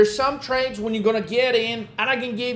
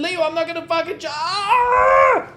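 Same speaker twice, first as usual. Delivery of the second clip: fast dithering that breaks into a high, loud shriek; mock scream; 8 semitones higher, 3 dB louder than usual. Mock client losing it over the missed trade, ending in a shriek. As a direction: let the frustration snap into a scream. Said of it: "this motherfucker is like".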